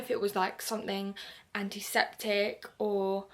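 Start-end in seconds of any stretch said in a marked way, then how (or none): none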